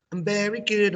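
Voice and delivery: high bright answer, "good" falling; teacher modeling a happy reply; cheerful model answer. Shown as a demonstration. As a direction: sing out "good" to the class. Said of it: "I do the English".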